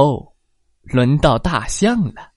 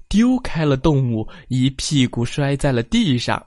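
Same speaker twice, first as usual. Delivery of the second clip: usual speaking voice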